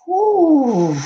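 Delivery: long high falling "whew". Real, not acted. Host reacting to the energy, high falling whew. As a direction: let out a high, sliding whoop of breath.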